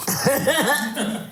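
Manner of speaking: laughing. Real, not acted. Laughing at own joke.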